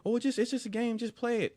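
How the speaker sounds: high-pitched imitation of friends urging; coaxing party guy. Guys urging a reluctant friend to play, coaxing. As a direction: brush off the worry in a lifted voice.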